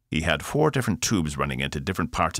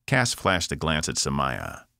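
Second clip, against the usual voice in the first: ordinary delivery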